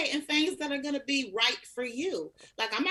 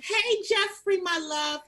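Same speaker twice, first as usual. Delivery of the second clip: louder, higher warm hello; excited greeting; 7 semitones higher, 3 dB louder than usual. Real, not acted. Welcoming fan Jeffrey, second take. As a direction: greet him with a big welcome. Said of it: "Let me do that again".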